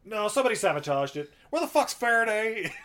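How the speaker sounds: hammed-up captain barking accusations; mock angry captain. Captain insisting on sabotage.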